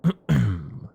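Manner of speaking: loud, deliberate throat clear. Acted. Vampire getting back to the point, clearing the throat.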